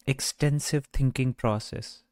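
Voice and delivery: ordinary talk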